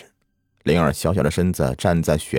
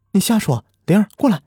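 angry disbelief; 13 semitones higher, 3 dB louder, faster than usual. A narrator's reading. Mother refuses to believe.

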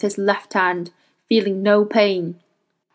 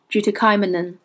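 usual speaking voice

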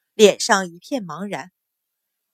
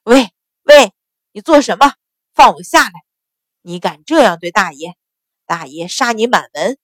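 panicked shouting; 5 dB louder than usual. A narrator's reading. 钱旺 yells at 赵成 dragging him off, panicked shout. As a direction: yell in alarm as you're lifted. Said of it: "大声叫道".

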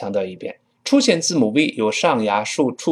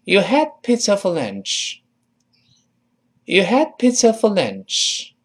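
higher-pitched model sentence with falling end; model reading; 6 semitones higher than usual. Shown as a demonstration. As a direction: read it out clearly for learners to copy. Read again with teacher. (音调提高的领读示范)

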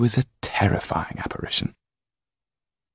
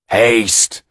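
loud, higher, abrupt command; sharp steward; 4 semitones higher, 7 dB louder than usual. A narrator's reading. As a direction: cut the magician off sharply. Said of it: "Milka repeated sharply".

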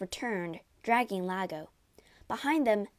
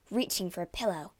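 ordinary delivery

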